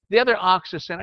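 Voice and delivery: ordinary delivery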